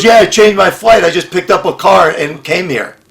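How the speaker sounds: very high, loud offhand voice; carefree friend. Retold or imitated. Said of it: "He goes".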